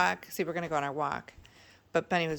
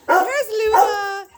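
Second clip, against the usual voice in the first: very high, louder, slow drawn-out coo; sing-song pet talk; 8 dB louder, slower than usual. Real, not acted. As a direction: croon at the dog Luna. Sing-song greeting to dog Luna.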